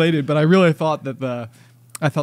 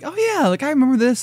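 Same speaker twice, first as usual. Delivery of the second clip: much higher, sing-song remembering voice; high cartoon recognition; mock recognition; 9 semitones higher than usual. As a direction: play the delighted kid remembering. Imitated recognition.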